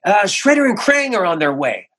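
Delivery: a light take on the straight-man leader; Leo's hero voice. Retold or imitated. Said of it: "And he says".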